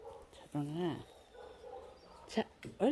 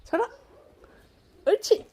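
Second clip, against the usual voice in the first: very high squeaky good-dog praise; praising a dog; 12 semitones higher, 6 dB louder, much faster than usual. Real, not acted.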